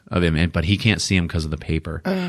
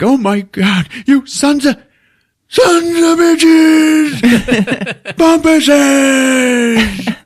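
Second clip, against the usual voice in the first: high, very loud anguished screaming; furious dad; 7 dB louder, much slower than usual. Acted. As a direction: bellow curses, building to a wail. Dad curses dogs destroying the turkey.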